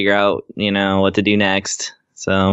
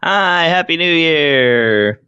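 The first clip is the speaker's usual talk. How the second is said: slow, high, honking like a party blower; noisemaker impression; 7 semitones higher, much slower than usual. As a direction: stretch each word out like a toy horn. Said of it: "that's my impression of a noisemaker".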